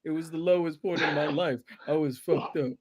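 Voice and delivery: quiet mock remorse; imitating a canned apology. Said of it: "That's, that's always the line".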